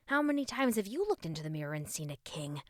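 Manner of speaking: ordinary delivery, nothing marked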